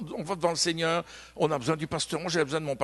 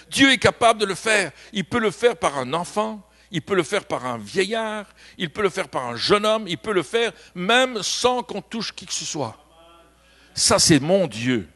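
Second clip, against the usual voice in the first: louder, emphatic preacher's proclamation; fervent preaching; 4 semitones higher, 4 dB louder than usual. Acted. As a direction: hammer each line home with faith. Preaching at full force.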